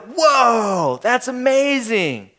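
slow, drawn-out mock amazement; mock wowed. Mocking how amazed hometown folks were not. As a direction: ham up a starstruck "whoa". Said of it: "they weren't like".

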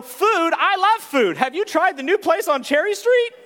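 bubbly and eager about lunch; excited yellow. Retold or imitated. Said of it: "the yellows go".

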